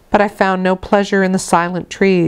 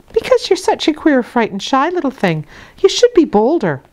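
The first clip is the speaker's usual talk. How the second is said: nursemaid's voice; 8 semitones higher than usual. A narrator's reading. Bessie voiced in story.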